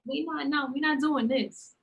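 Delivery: high, stammering, half-laughing refusal; telling her dead uncle's ghost to stop, high and pleading. Retold or imitated. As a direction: beg him to back off, comically. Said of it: "I was like".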